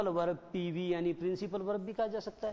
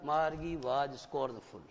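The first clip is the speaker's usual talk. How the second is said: slow reading aloud; 4 semitones lower, much slower than usual. Shown as a demonstration. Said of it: "जरा ध्यान दो एक बार".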